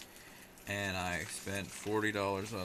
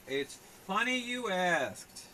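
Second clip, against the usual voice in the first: mock ominous; 9 semitones higher, 4 dB louder, slower than usual. Mock ominous reply to chat.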